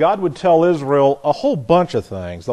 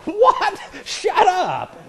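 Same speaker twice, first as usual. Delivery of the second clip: high, laughing what, shut up; a dad laughing back at his son; laughing mock protest; much slower than usual.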